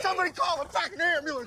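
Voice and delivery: desperate shout; frantic yell. Acted. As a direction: shout across the park for an ambulance. Yelling for help after shooting.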